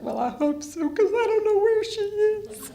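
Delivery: the frightened mother on the phone, near tears; crying. Retold or imitated. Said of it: "and I'm crying".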